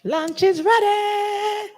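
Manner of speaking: high, slow sing-song call across room; drawn-out high announcement. Real, not acted.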